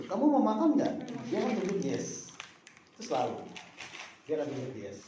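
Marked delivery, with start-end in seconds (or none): 0.1-0.9 "Kamu mau makan nggak?" in a high question tune, rising at the end; imitated caregiver's question, "Kamu mau makan nggak?" lifted high; that same question intonation. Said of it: "dia akan pakai intonasi itu".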